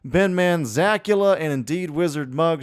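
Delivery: ordinary talk, nothing marked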